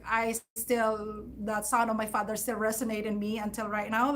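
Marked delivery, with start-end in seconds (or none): none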